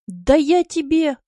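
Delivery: loud, slow angry outburst; bristling threat. A narrator's reading. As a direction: flare up at him. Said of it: "взъерепенился было Василий". (вспылил, громко и грозно)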